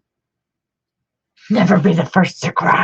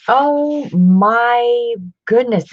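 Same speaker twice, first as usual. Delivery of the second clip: drawn out, higher and louder; delighted gasp; 7 semitones higher, 5 dB louder, much slower than usual. Real, not acted. Spotting art on the second page, a slow delighted exclaim. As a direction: stretch it in pure delight. Finding more illustrations.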